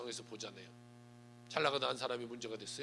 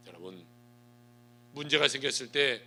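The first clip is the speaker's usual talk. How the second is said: ordinary talk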